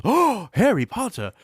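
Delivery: high, awed fan gasp; imitating an awestruck fan; starstruck gush. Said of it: "Everybody else goes".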